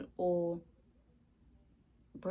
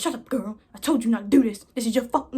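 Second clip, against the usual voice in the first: a bit higher and louder, scolding; scolding aunt; 4 semitones higher, 9 dB louder, faster than usual. Retold or imitated. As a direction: tell off your niece. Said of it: "My aunt's like".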